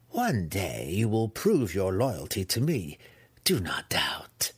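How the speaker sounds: slow matron voice sinking to a whisper; menacing matriarch. Acted.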